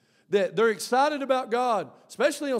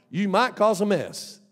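ordinary talk, nothing marked

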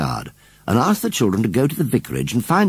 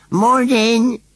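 very high, louder, pleading "Morning"; pathetic repeat; 13 semitones higher, 5 dB louder than usual. Acted. Scarecrow calls after the departing boy, pathetically. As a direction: call it after them, pitiful and forlorn. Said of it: "repeated Gummidge pathetically".

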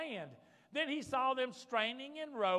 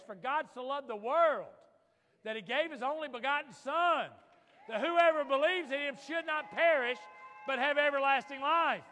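preaching loud; 4 semitones higher, 8 dB louder than usual. Acted. Quoting John 3:16 at full force.

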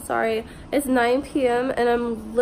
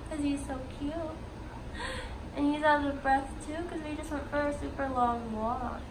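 cooing to a pet; 4 semitones higher, 9 dB quieter than usual. Real, not acted. Cooing over her dog.

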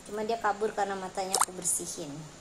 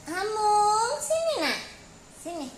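very high sing-song call; 1 calling the cat; 9 semitones higher, 5 dB louder than usual. Real, not acted. Owner calling Hamung over, very high pet call.

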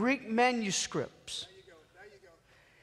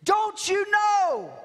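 desperate cry; 9 semitones higher, much slower than usual. Acted. Voicing a panicked prayer.

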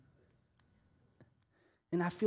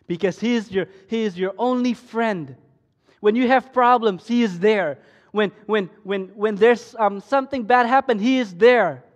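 loud, higher preaching; preaching at full force; 6 semitones higher, 14 dB louder than usual. Acted. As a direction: preach it out to the young people.

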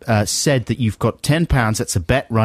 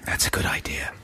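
soft unvoiced whisper; conspiratorial whisper; 9 dB quieter than usual. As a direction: breathe it out as a secret whisper. Hushed reply, whispered.

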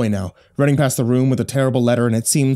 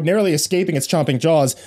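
ordinary delivery, nothing marked

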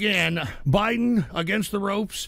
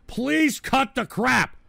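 slow plea; 5 semitones higher, 5 dB louder, slower than usual. Real, not acted. Begging chat to stop.

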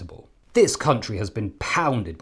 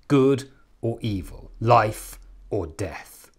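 slow ominous; slower than usual. Acted.

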